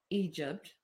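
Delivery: normal talking voice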